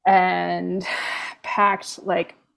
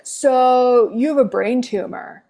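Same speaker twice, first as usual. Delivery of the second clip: blunt resident; 5 semitones higher, 5 dB louder than usual. Retold or imitated. Doctor voiced bluntly.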